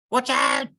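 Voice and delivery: high, loud warning squawk; alarmed squawk. Acted. Chook sees the big wave and warns Bear in alarm. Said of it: "squawked Chook".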